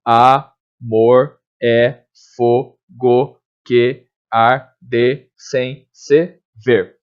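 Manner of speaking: "Amor é fogo que arde sem se ver" drawn out slowly, each syllable separated; scanning a line of verse for students; syllable-by-syllable scansion. Shown as a demonstration. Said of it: "a gente vai encontrar dez sílabas poéticas, ó".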